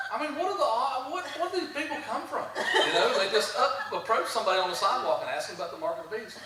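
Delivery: loud laugh running through the words. Real, not acted. Laughing at a strange question.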